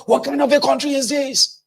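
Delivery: high-pitched exasperated outburst; angry exasperation. Acted. An angry Nigerian asked how he reacts, furious cry. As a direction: fling the question out in fury. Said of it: "how do you react when you're angry".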